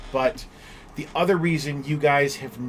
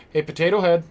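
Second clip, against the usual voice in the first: raised, bright call to a puppy; 4 semitones higher, 6 dB louder than usual. Real, not acted. Streamer calls her puppy Potato Head, loud playful call. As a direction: call out to the dog by nickname.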